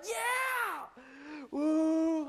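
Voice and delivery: high, drawn-out roller coaster whoop; squealed mock cheer, far above your talk; thrilled cheer. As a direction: whoop like you're mid-ride. Acts out cheering on the ride.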